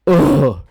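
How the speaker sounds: a big loud groan of effort. Retold or imitated. A heavy person groaning loudly as they sit down. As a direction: groan hard as you drop into the seat. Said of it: "you go".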